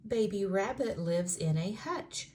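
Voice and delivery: plain reading voice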